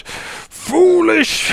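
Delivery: high, loud, drawn-out cry; villain's shout. Acted. Villain calling out his enemy, theatrical shout. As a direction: shout it like an outraged arch-villain. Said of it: "Anrakin, uh, shouts out".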